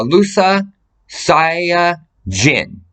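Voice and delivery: each syllable drawn out slowly; "Lusa Saya Jin" stretched syllable by syllable, very slow; slow spelled-out name. Shown as a demonstration. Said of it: "And I'll give you her name".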